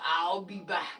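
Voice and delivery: deep Terminator line; Arnold Schwarzenegger. Shown as a demonstration. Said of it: "I can definitely do the".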